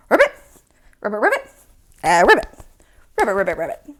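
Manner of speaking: quick playful ribbits in bursts; frog croak. Pretend. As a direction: chatter like a little frog. Telling frogs good job as frog.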